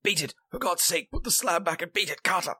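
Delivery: high, desperate cry; piteous cry. A narrator's reading. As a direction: cry out, pleading in panic. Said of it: "a piteous cry from Warren".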